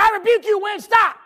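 high, shouted angry command; preacher acting out a mad shout; mad rebuke. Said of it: "He was mad".

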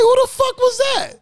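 startled and laughing; shocked laughing yell. Retold or imitated. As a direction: burst out in disbelief. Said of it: "I was like".

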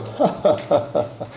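airy imitation of a nervous laugh; mock laugh. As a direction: let out a breathy fake laugh. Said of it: "have you ever noticed laughing goes".

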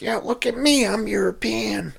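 high mock comedian voice; mocking high-pitched stage persona; Sam Kinison. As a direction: put on a high-pitched preening voice. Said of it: "you listen to Sam Kinison".

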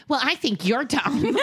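high, slow snap-back; childish retort. Pretend. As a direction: throw the insult back like a petulant kid. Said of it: "I wanted to be like".

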